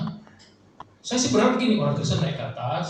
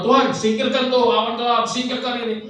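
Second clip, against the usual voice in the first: demanding prayer; 6 semitones higher, 5 dB louder than usual. Pretend. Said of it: "nggak pernah berdoa".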